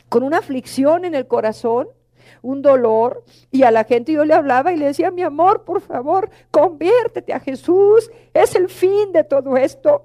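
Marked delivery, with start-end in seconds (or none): 5.1-10.0 ruego más agudo y lento, con ternura; súplica tierna. Retold or imitated. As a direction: suplica a la gente que se convierta. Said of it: "Mire, les lloré". (voz de ruego cariñoso, más aguda y pausada)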